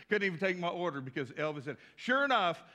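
ordinary talk, nothing marked

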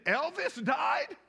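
high, slow, astonished question; a teen boy stunned by the news; stunned disbelief. Retold or imitated. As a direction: drag out the shock as you ask it back.

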